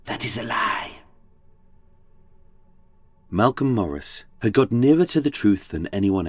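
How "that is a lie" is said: hushed, offended whispered denial; soft whispered protest; indignant whisper. Acted. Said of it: "To which Christie indignantly whispered".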